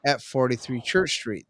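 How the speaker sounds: usual speaking voice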